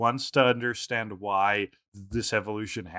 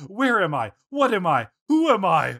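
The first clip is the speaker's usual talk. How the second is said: high, mock-bewildered cries; three high falling wails, each louder; Meowth; 7 semitones higher, 3 dB louder than usual. Host imitating Meowth lost under psychic powers, high mock panic. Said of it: "this time Meowth goes".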